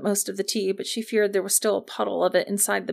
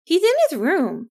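high, louder character voice; phone-answerer's voice; 8 semitones higher, 5 dB louder than usual. A narrator's reading. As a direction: answer in a high-pitched, raised voice.

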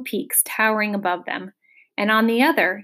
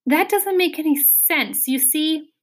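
explaining; 7 semitones higher than usual. A narrator's reading. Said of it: "he started to explain".

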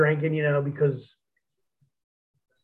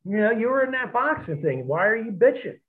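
higher, exasperated retelling of a buddy's scolding; mock exasperated rant, voice pitched up; retold disbelief; 7 semitones higher than usual. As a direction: scold him like a buzzed friend who can't believe it. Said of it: "Steve was just like".

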